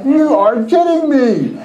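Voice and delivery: high, slow, drawn-out disbelief; mock astonishment. Preacher hearing of a second win, astonished. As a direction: act out stunned disbelief.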